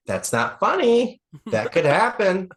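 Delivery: high, loud, slow mock panic from the auntie; alarmed aunt. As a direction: squeal it like a worried aunt. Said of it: "my Auntie Amy's going".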